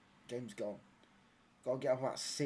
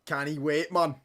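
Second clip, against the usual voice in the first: louder put-on Scottish-style accent; Scottish-sounding accent; 2 semitones higher, 8 dB louder, slower than usual. Football streamer hamming it up for the chat, mock Scottish accent. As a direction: call it out in a mock Scottish lilt. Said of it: "you fucking Scottish".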